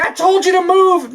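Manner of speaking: hammed-up scolding brother; mock yell. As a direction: bark it at him with a laugh. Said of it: "he starts being like".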